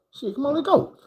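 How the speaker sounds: put-on high, excited squeak of a kid; eager high yelp. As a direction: jump at the chance, voice cracking up high. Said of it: "I'm like".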